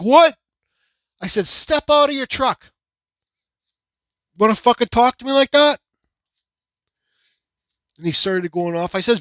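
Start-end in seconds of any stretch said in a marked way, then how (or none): 0.0-0.4 loud, high, rising mimicked reply; retold indignant question. Other driver answering the order, retold indignant question. 1.5-5.8 loud, high confrontational demand; retold angry order. Driver ordering the man from his truck, retold angry order.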